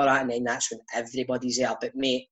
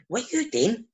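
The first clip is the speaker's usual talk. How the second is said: puzzled coach; 9 semitones higher than usual. Retold or imitated. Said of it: "Bob's like that to me".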